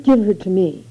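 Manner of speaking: a touch of fierce demand, lightly voiced; understated fierce mother claiming her child. A narrator's reading. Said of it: "Then, fiercely".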